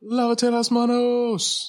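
high, very slow mock scolding; exaggerated scolding. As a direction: drag it out like a nagging mother. Repeats Spanish command, exaggerated.

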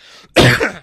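loud hacking cough; chesty cough. Real, not acted. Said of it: "la tosse continua a perseguitarmi".

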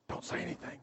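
whispered, voiceless hush; hushed warning. Retold or imitated. Disciple hushes the others so Jesus won't hear, whispered.

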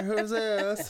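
laughing, lightly put-on Indian accent on new car; mock Indian accent. Joking to a friend about a new car, in a light Indian accent. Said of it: "apparently I'm Indian".

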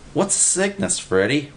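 a wide-eyed eager pal; excited simple guy. A narrator's reading. Narrator voices Willie.

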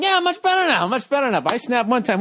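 high-pitched mimicry of the host; mocking imitation. Co-host teasing the host about snapping, high mock imitation. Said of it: "He's doing this whole thing".

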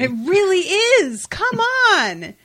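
high, drawn-out lament; very high, slow, emphatic whine; exasperated protest. Real, not acted. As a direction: plead loudly against the injustice of it. Laments the missed opportunity.

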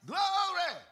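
loud, high, drawn-out praise shout; joyful shout. Retold or imitated. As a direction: shout it out with joy. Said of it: "you're gonna say".

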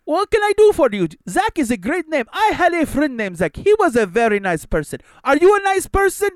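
high, mock accented agent; creepy agent voice.

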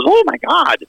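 a sudden high mock-shocked cry; shocked oh my God. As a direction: act floored and astonished. Old guy shocked by four brownies.

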